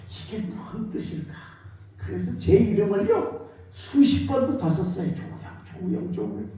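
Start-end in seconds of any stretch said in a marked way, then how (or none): 0.1-1.3 whispered musing. Retold or imitated. Musing alone in his study. 5.1-6.5 whispered name. Retold or imitated. Writing his name over and over.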